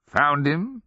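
lightly voiced mischievous old man; old man's echo. A narrator's reading. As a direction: toss it back as a puzzle. Old sergeant echoes the question.

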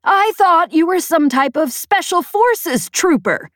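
slow, high-pitched indignant shout. A narrator's reading. Peg tells the pilot what she assumed, shouting. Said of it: "she shouted".

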